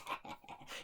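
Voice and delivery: soft, voiceless snicker; sly chuckle. Acted. Innkeeper lures the traveller in, sly soft snicker. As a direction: chuckle under your breath.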